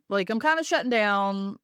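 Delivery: ordinary talk, nothing marked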